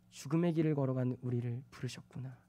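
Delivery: ordinary talk, nothing marked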